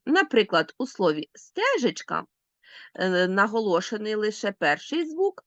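ordinary delivery

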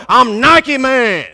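slow, loud Nike man; proud declaration. Shown as a demonstration. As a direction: catch them by surprise. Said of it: "walk in the office and go".

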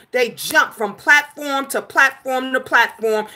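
normal talking voice

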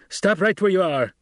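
high, loud, snapped command; snapped order. A narrator's reading. Captor halting the advancing son, snapping. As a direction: snap at him to halt. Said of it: "Gurney snapped".

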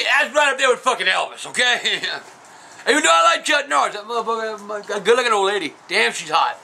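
ordinary talk

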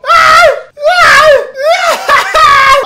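screaming laughs, very high and loud; helpless laughter. Real, not acted. Watching the rapper cry on video, howling laughter. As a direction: lose it completely, howling with laughter.

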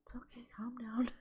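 a fast hushed whisper to herself; self-soothing whisper. Real, not acted. Whispering to herself to calm down while angry. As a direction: whisper quickly to calm yourself down.

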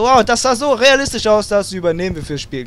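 playful parody of impressed game makers; mock amazed developers. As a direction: ham up the awestruck studio crew. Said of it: "und dann alle so".

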